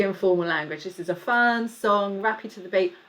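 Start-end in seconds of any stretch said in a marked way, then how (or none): none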